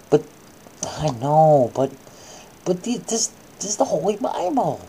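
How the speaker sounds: ordinary delivery, nothing marked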